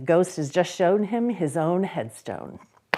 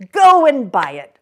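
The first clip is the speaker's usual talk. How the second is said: loud command; 7 semitones higher, 13 dB louder than usual. Acted. Scrooge orders loudly.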